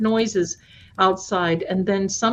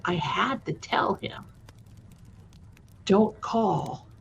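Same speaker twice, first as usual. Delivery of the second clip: hushed whispered mom; mom whispering; 6 dB quieter, slower than usual. Retold or imitated. Mom recalling a warning to the boyfriend, whispered. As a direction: whisper it like a secret.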